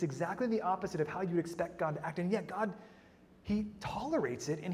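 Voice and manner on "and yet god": ordinary talk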